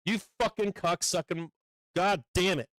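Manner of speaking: furious, frustrated outburst. Real, not acted. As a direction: curse in real fury at a lost trade. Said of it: "I'm so fucking furious right now".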